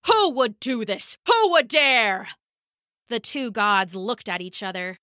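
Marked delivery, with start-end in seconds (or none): 0.1-2.3 indignant demand, climbing higher; outraged bellow. Acted. Thor demanding the culprit, outraged bellow. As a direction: stretch the questions in god-sized outrage. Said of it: "bellowed Thor".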